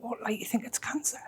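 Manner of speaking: hushed, tearful retold question; starting to cry. Patient asking the nurse if it's cancer, hushed and tearful. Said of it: "I, like, started to cry".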